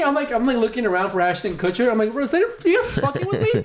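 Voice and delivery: high squeaky disbelief, way up in pitch; half-shrieking what are you kidding me; incredulous. Retold or imitated. Ranting to ATM staff who refuse the cash, incredulous and high.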